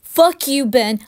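ordinary delivery, nothing marked

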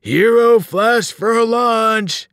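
slow, high, loud monster chant; bellowing giants. A narrator's reading. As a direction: bellow hungrily, stretching every word. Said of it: "they bellowed".

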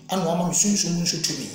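ordinary talk, nothing marked

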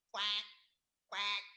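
loud, separated duck quacks. Shown as a demonstration. Teacher showing how a squirrel tree frog sounds, loud duck quacks. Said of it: "you know what a duck says".

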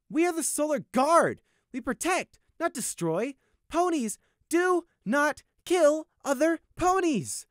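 high, loud outraged shouting. Acted. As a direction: confront him in outrage. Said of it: "scowled in outrage".

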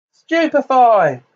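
high, drawn-out battle yell; yelled spell. A narrator's reading. Harry fires a stunning spell at Bellatrix, yelling. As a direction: hurl the incantation out loud and slow. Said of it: "yelled Harry".

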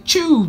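high loud sneeze burst. Shown as a demonstration. Explaining sneezes to the class, a loud sneeze. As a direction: fire off the sneeze. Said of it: "That's when you say".

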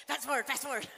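said through a laugh. Retold or imitated. Mother telling kids to skip sad cartoon, laughing. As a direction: laugh it off while skipping ahead. Skipping cartoon scenes of Jesus hurt.

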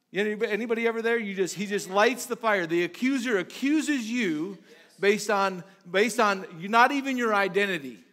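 high-energy sermon pitch; impassioned preaching. Real, not acted. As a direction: press each phrase home at full preaching force. Preaches with rising force.

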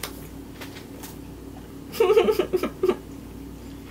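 breathy high giggle; delighted giggle. Real, not acted. First bite of the skewer.

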